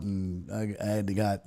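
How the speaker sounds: ordinary delivery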